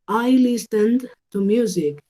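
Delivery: slow, careful example read; slow model sentence. Shown as a demonstration. Teacher reading an example sentence slowly for students. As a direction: model it slowly for learners to copy.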